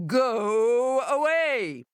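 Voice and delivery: slow, booming warning through the door; booming monster. A narrator's reading. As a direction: boom it out like a hidden beast. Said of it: "the voice called".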